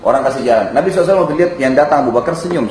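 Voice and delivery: ordinary talk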